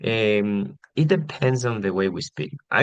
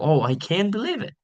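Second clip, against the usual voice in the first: high, rising dramatic tone; enthusiastic surprise; 9 semitones higher than usual. Shown as a demonstration. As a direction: demonstrate enthusiasm with pitch climbing on "believe it". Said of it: "rising and dramatic tone".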